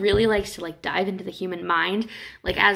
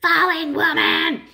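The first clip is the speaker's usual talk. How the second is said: much higher, louder, slow mock jeer; a hammed-up scandalised cry; accusing crowd shout; 9 semitones higher, 6 dB louder, slower than usual. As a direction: jeer at her like the whole town. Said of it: "everyone looks at her and goes".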